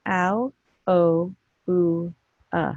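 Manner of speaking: "Ow", "oh", "ooh", "uh" as separate vowels; phonics sounds. Shown as a demonstration. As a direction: sound out "Ow, oh, ooh, uh" evenly, pausing between. Teacher repeats the four sounds.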